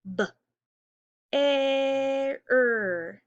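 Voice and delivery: B, e, r sounded out slowly, each sound held apart; sounding out words. Shown as a demonstration. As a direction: stretch bear into its sounds B, e, r. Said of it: "Listen again".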